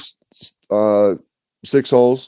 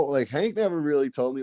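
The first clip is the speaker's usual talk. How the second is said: ordinary talk, nothing marked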